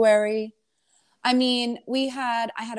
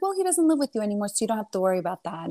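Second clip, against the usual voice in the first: rattled off fast to her mother; fast brisk comeback; quick retort; much faster than usual. Retold or imitated.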